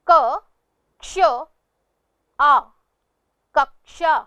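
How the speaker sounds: "क, क्ष, आ" separately, then whole "कक्षा"; sounding out. Shown as a demonstration. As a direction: break "कक्षा" into spaced sounds, then join them. Teacher sounds out word, then blends. ("क, क्ष, आ" अलग-अलग, फिर पूरा "कक्षा")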